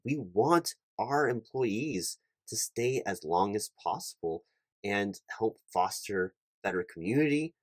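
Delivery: ordinary talk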